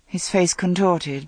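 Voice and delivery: ordinary delivery, nothing marked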